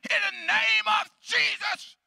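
high, slow, shouted invocation; re-enacted loud prayer. Preacher praying again for the blind man, loud shout. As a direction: belt out the prayer at full volume. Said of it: "thinking my loudness is gonna do something".

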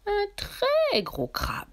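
"très" drawn out long and high; stress on très. A narrator's reading. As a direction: stretch "très" high, then drop on "gros crabe". L'intensif est étiré pour insister. (insister sur « très », aigu et très allongé)

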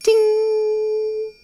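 very high sweet ting; ping it out like a tiny bell; high chime sound. Acted. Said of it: "he just comments sweetly".

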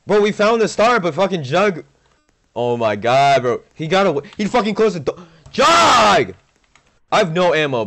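loud, high frantic outburst; panicking gamer. Real, not acted. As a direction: yell in frustrated panic. Target escapes, frantic reaction.

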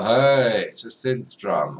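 normal talking voice

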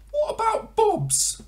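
very high, loud, slow squawk; a squawking budgie; budgerigar. Acted. Budgerigar calls to frog, very high and loud.

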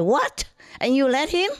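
protective, outraged at the father; indignant outburst. Retold or imitated. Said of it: "I was just kind of protective".

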